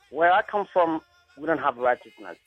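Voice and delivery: ordinary talk, nothing marked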